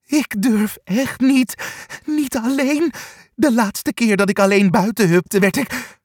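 plead, shaking with fear; trembling with fear. A narrator's reading. Angsthaas, trembling, tells the others he dare not go alone. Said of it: "begint nu hevig te trillen".

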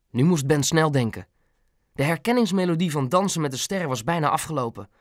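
ordinary delivery

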